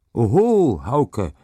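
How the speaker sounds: high, loud cheer from the crowd; calling out. A narrator's reading. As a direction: whoop at a great throw. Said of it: "rief es aus dem Haufen".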